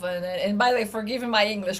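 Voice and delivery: ordinary delivery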